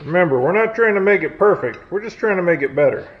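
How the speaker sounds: usual speaking voice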